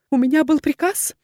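high, anxious woman's voice; frightened confession; fearful explaining. A narrator's reading. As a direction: justify yourself in a high, pleading voice. Terrified woman explains herself to duke.

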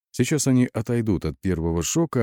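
ordinary delivery

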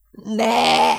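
very high, long yell; howled protest. A narrator's reading. Airman sees his medal thrown out, howling. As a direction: scream it out in horror. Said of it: "üvöltött fel Eugene".

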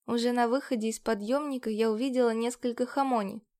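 usual speaking voice

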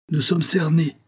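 high-pitched cry of alarm; alarmed exclamation. A narrator's reading. Marauders find themselves trapped, crying out in alarm. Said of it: "s'écrièrent les maraudeurs".

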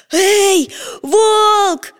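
loud, high call after running; breathless shout. A narrator's reading. Petya calls after the wolf, loud and breathless. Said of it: "закричал Петя, задыхаясь".

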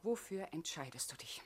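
voiceless whispered question. Acted. Olga pressing Hugo for his choice, whispering. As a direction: ask it in a hush.

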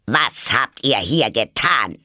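slow, emphatic angry question; stern demand. A narrator's reading. Queen interrogating the gardeners by the rose tree, stern demand. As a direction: demand it word by word, slowly.

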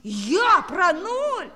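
drawn-out indignant question; indignant surprise. Acted. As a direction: act offended at the idea. Pupil denies forgetting zero.

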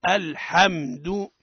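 الحمد very slow, open a and held m; model pronunciation. Shown as a demonstration. Teacher asking the class to repeat, slow model pronunciation. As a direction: stretch out الحمد for repeating, every letter distinct. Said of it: "قولوا".

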